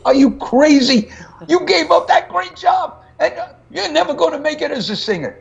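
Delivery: high, shrill-pitched mock scream of a mother. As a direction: play the panicked mother, pitch way up. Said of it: "She was screaming".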